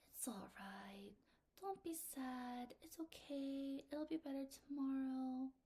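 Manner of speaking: slow, hushed soothing voice; cooing, comforting mom. Acted. Mom comforting child having a bad day, soft cooing. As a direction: soothe a sad child softly, drawing words out. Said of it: "my mom coos and comforts me".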